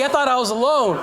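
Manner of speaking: ordinary delivery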